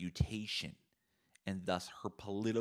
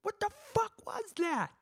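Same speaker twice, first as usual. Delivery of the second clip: shrill, very high falsetto mom freakout; mom losing it; 4 dB louder than usual. Acted. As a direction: screech it like a furious mother, hammed-up. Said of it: "then she loses her shit".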